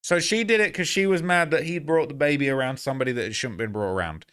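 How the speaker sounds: ordinary delivery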